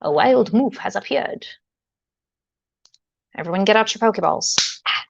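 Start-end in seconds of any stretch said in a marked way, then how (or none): none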